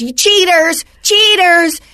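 hint of a sulky boy yelling cheaters; mock kid accusing. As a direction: whine it like a kid who just lost.

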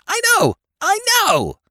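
very high, loud shout. Acted. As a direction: yell it out frantically. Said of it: "he shouted".

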